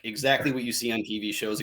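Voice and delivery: ordinary delivery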